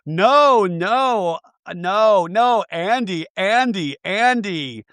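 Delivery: high, drawn-out mock wail; hammed-up whiny pleading; mock wailing protest. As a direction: imitate a distraught plea, each word swooping up high. Acting out Lisa's tearful protest.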